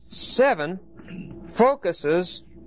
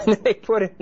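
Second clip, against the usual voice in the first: higher, with laughs breaking in; real chuckling between phrases; amused laughter; 4 semitones higher than usual. Real, not acted. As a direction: laugh while getting the words out. Laughing at an odd translation margin.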